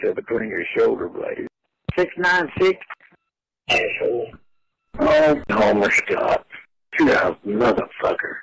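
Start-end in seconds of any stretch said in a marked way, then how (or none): none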